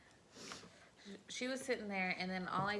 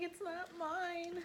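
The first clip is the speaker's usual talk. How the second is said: mock crying kid; 10 semitones higher, much slower than usual. Crying kid voice in retold story.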